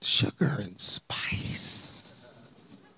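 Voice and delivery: slow soft whisper; a slow, sweet whisper; whispered sweetly. Shown as a demonstration. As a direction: breathe it out ladylike and slow. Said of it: "you gotta talk like a lady".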